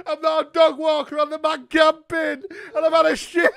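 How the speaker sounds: high, loud, hammed-up wailing; mock crying confession. Caller fake-wailing a confession to the water company. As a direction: confess through theatrical fake sobs. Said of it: "caught up in my emotions".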